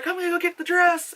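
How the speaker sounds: put-on high-pitched girl; girl's voice. Girl sending dad for the dress, high girl's voice. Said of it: "the girl's like".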